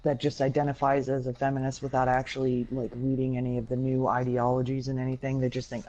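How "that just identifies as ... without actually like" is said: ordinary delivery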